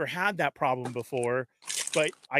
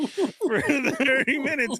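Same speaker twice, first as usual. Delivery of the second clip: high laughing words, slowed; 9 semitones higher, 3 dB louder, slower than usual. Real, not acted. Waiting thirty minutes for the key, talking through a high laugh. Laughs while telling the wait.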